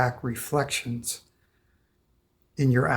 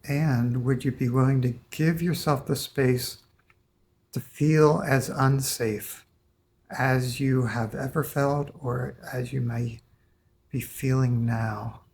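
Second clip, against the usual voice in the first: guided meditation. Acted. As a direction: invite them gently, one step at a time. Guides client through release.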